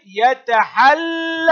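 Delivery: drawn-out "yata-hal-la" for يتحل. Shown as a demonstration. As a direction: lead the class, holding the double l of يتحل. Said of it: "هيا انطقوا معي".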